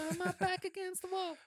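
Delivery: very high-pitched mock denial; mock protest. Host playfully denying he's defensive, high mock protest. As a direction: squeal it as a joking protest.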